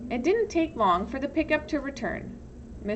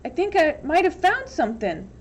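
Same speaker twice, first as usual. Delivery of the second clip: high, loud excited call; shouting; 7 semitones higher, 5 dB louder than usual. Acted. Boy calling the counselor back over his find, shouting. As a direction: keep yelling to hold him back. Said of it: "X-Ray shouted from his hole".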